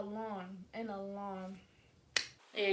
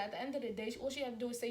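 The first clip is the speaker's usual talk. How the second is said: ordinary talk, nothing marked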